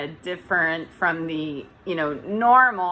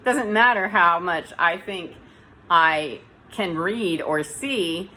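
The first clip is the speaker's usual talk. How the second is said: ordinary talk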